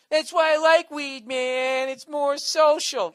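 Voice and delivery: loud, high, drawn-out pothead whine; mocking stoner voice. Pothead defending shared joints, loud mocking drawl. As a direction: stretch it out as a hammed-up stoner.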